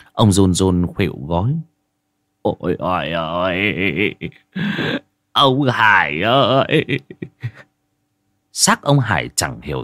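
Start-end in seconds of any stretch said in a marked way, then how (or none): 2.4-6.9 voiced lament, slow at the end; grieving wail. Old man wailing over his friend's corpse, grieving. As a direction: cry out over a friend's body.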